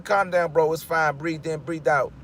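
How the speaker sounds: dropping low to settle yourself; calming self down. Real, not acted. Talks himself down after getting worked up.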